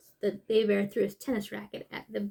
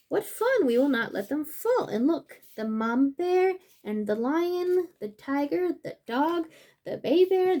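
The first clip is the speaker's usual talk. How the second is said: higher, brighter storybook reading; reading to children; 4 semitones higher, 5 dB louder than usual. Acted. Reading the book's happy ending, higher and bright. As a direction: read the happy ending with joy.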